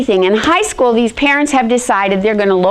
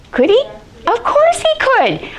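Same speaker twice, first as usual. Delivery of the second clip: much higher, emphatic rhetorical question; 13 semitones higher than usual. Real, not acted. Speaker to audience about her baby, emphatic. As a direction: punch it up, high and sure.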